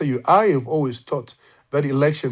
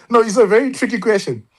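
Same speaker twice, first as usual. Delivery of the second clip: laughing; 10 semitones higher, 4 dB louder, faster than usual. Real, not acted. Caught off guard by question, laughing.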